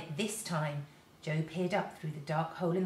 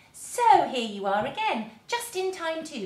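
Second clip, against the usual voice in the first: slow, louder greeting with a smile; smiling welcome; 8 semitones higher, 7 dB louder than usual. A narrator's reading. Silky welcoming the children at her door, smiling. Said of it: "she said, looking up and smiling".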